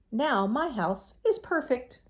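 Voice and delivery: slow, loud happy cheer. A narrator's reading. Hermit Crab cheering over his new wall, slow and loud. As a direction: cheer it out proudly. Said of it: "cheered Hermit Crab".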